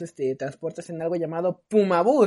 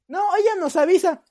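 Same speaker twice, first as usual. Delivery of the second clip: higher and louder, smugly certain; stubborn confidence; 9 semitones higher, 8 dB louder than usual. Retold or imitated. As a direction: insist like you know better. Enzo insists stubbornly, confident. (terquedad confiada)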